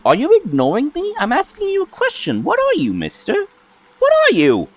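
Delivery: put-on high childlike creature, fast and insistent; 1 impatient fairy voice. GM voicing the fairy nagging the silent ranger, high and impatient. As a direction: pitch way up, rattle off demands like a pestering kid.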